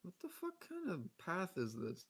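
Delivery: normal talking voice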